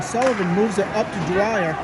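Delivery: ordinary talk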